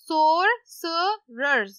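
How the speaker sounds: Sorcerers drawn out very slowly, falling at the end; slow syllables. Shown as a demonstration. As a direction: model Sorcerers very slowly for the class. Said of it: "कैसे पढ़ेंगे?". (Sorcerers को धीरे-धीरे बोलकर दिखाएं)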